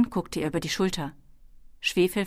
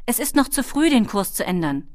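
high, raised protest, settling at the end; calling out; 5 semitones higher, 6 dB louder than usual. A narrator's reading. Ben objects to changing course early, calling out. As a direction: argue against it loudly, starting high. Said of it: "rief er".

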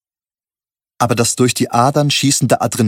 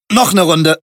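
loud, higher barked order; 1 gruff order; 7 semitones higher, 5 dB louder than usual. A narrator's reading. Drunk man at the bar orders loudly. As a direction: slam it down and demand another round. Jas slams glass, orders.

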